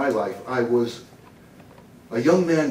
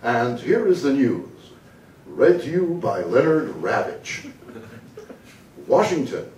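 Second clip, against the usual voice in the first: news announcer voice. Acted. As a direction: introduce the bulletin like a proud anchor. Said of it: "the, the voice".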